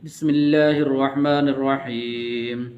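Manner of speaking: slow, measured tajweed recitation; solemn chanted Quran opening. Acted. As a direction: recite slowly and evenly.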